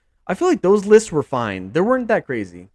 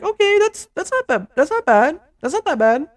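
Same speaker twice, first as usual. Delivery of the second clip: much higher, mock-casual reassurance; future fan shrugging it off; 13 semitones higher than usual. Fans looking back on the contract, breezy high shrug. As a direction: toss it off lightly, pitched way up. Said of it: "we're gonna look back and be like".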